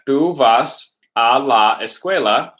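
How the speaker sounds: slow, syllable by syllable; slow modelled Spanish. Shown as a demonstration. Teacher models the Spanish sentence slowly for students. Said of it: "So we got".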